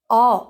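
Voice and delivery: अ said as a sound between आ and ओ. Shown as a demonstration. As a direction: open अ, halfway between आ and ओ. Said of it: "जिसका उच्चारण होता है आ और ओ के बीच का".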